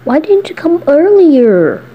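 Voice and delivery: put-on high, slow, sulky boyish whine; whiny Mikey. Mikey whines to listener about being left alone. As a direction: draw it out like a lonely kid.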